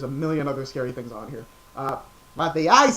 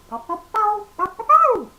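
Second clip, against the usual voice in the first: much higher, louder cartoon puppy barks; Blue barking; 8 dB louder than usual. Doing the cartoon dog for viewers, high yapping barks. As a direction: yap it like a small cartoon dog. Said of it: "you can do Blue from, uh, Blue's Clues".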